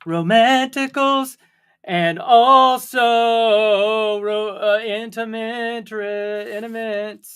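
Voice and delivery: slow, drawn-out mock seductive; playful romantic voice for the viewers. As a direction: ham up the romance. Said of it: "set this up all romantic".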